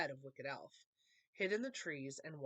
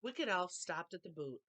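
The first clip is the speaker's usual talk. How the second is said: plain reading voice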